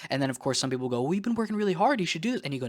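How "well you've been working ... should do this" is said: much higher, sing-song mock coaxing; coaxing tempter. Friends urging him to reward himself, high coaxing mock voice. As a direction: tempt them like a sweet-talking friend.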